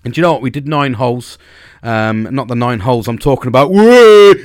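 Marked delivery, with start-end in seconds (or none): 3.7-4.3 loud, high, drawn-out "whoa"; sudden silly whoop. Real, not acted. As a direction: burst into a big jokey holler. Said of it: "I don't know why I did that".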